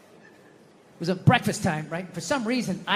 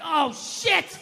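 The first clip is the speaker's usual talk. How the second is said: alarmed realization; 6 semitones higher than usual. Acted. Father's alarm at upsetting his daughter.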